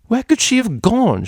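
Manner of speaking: a haughty mare's shrill insistence; demanding. A narrator's reading. Mare demanding answers from the stallion, high and loud. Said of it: "she demanded".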